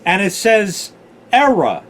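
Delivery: put-on New York accent, error said as ERA with no R at the end; a friend's non-rhotic New York accent, error said ERA. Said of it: "Like E R A".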